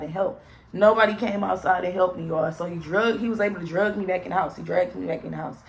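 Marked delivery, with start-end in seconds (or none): none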